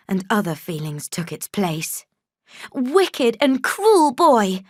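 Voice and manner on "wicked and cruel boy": put-on high, slow, outraged child's cry; accusing girl. A narrator's reading. Girl accusing her cousin, high and slow. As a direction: fling the words at the cruel boy. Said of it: "I said".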